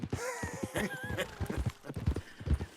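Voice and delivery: big burst of laughter at the memory; hearty laugh. Real, not acted. Outlaw recalling a drunk friend, laughing hard.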